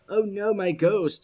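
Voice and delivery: mock despair put in the champion's mouth; voicing Volibear. Caster voicing Volibear losing his Ghost, a hint of mock dismay. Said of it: "says the Volibear".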